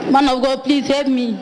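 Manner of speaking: usual speaking voice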